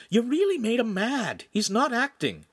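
high, pressing warning; urging. A narrator's reading. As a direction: warn a friend urgently without shouting. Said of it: "Shamira urged Lincoln".

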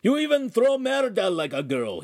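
high, loud taunt; mocking yell. Oaf taunting the man at the window, loud. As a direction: shout to mock the thrower. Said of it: "he cried".

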